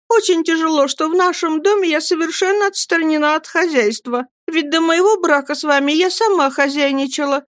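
high-pitched plaintive wife; flustered mother. A narrator's reading. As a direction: plead her case in a high, hurt voice. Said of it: "сконфуженно опять заговорила она". (сконфуженно, высоким жалобным голосом)

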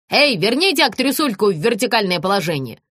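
ordinary delivery

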